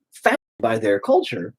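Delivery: ordinary talk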